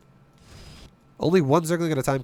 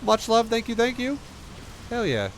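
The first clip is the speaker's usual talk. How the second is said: excited thanks; 9 semitones higher than usual. Real, not acted. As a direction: hype up the streamer who just raided. Thanking a raider on stream.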